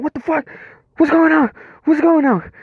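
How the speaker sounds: high, startled yelps of alarm; panicked shock. Retold or imitated. Startled man yelping at a lover's fingers. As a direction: shriek it in sudden panic. Panicked narrator mid anal surprise.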